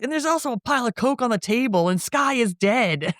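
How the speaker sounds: high, exasperated mock rant; imitated pissed-off rant. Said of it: "now he's all pissed off again".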